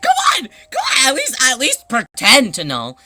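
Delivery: high, loud mock pleading; exasperated outburst. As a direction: cry out in laughing exasperation. Exasperated rant at employee, laughing.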